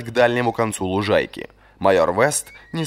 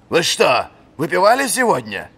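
high, louder stern interrogation; demanding question; 8 semitones higher, 4 dB louder than usual. A narrator's reading. Old master interrogating the captain about drinking. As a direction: demand an answer. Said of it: "требовательно спросил он".

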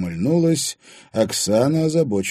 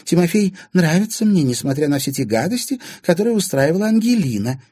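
high, emphatic woman's voice; Anfisa, changing her tone; 9 semitones higher, 5 dB louder than usual. A narrator's reading. Anfisa claiming to like her stepson, ingratiating. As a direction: protest your goodwill, pitched high.

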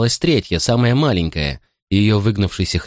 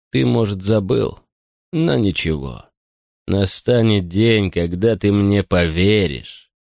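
sinister butler; slower than usual. Acted. Butler's long slow menacing speech.

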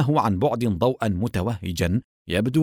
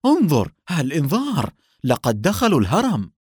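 raised, urgent warning cry; alarmed cry; 5 semitones higher, 3 dB louder than usual. A narrator's reading. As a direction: call out the alarm to a partner. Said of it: "وصرخ". (صيحة إنذار مذعورة)

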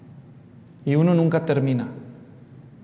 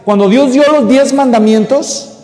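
full-force preaching; 7 semitones higher, 11 dB louder than usual. Acted. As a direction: thunder the scripture to the congregation.

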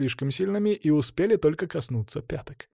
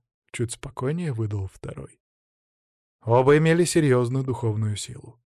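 ordinary delivery

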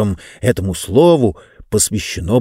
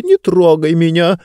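нервный взвизг; 6 semitones higher, 3 dB louder than usual. Acted. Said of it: "нервно взвизгнула книга".

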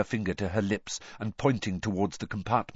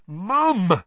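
loud, high exasperated call; irritable; 11 semitones higher, 7 dB louder than usual. A narrator's reading. Son fed up with mother's hints, irritable. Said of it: "said Ron irritably".

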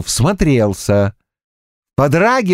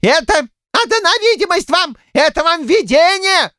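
put-on high-pitched old man bluffing; wily excuse. A narrator's reading. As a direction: try to wriggle out, voice high. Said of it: "пробовал схитрить щукарь". (хитрит, высоким стариковским голосом)

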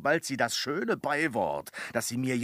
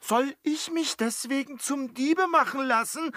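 indignant protest; 12 semitones higher, 4 dB louder than usual. Acted. Servant indignant at being called thief.

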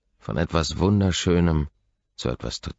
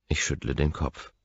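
usual speaking voice

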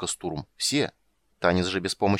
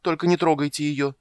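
higher, louder, pleading wail; wailing plea; 7 semitones higher, 4 dB louder than usual. A narrator's reading. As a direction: beg in panic, wailing. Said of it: "запричитала Маритта". (причитая в страхе)

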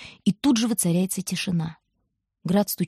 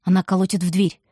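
usual speaking voice

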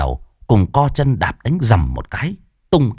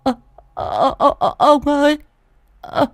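very high, stammering with fright; a terrified wife pointing at the corpse; shrill stammering panic, much higher; trembling old woman. Acted. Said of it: "bà Tính run run".

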